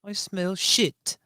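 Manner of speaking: high, slow hammed-up English queen; mock English accent. Cohost playing the Queen in a mock English accent. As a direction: join in as the Queen, posh and high.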